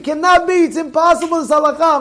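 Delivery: high-pitched mock protest; insistent, indignant outburst; retold screaming. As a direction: imitate a man loudly refusing to accept it. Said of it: "And he starts screaming".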